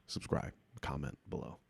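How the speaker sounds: whispered aside plug; muttered plug. Real, not acted. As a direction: toss off the plug under your breath.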